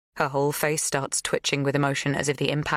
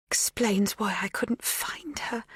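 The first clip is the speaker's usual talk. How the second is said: soft realization; 6 semitones higher, 4 dB quieter, slower than usual. A narrator's reading. Magda's realization.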